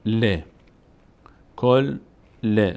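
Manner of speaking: short separate "ل" with its vowel, after closed "کل"; letter with own vowel. Shown as a demonstration. Teacher shows the second reading of l, set apart. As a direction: sound out "کل", pause, then "ل". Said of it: "به همراه حرکتی که خود لام داره".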